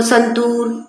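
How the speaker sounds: संतूर with a stretched long oo; long oo in santoor. Shown as a demonstration. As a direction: draw out the oo in संतूर. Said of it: "double ओ की जो sound है ... लंबी है".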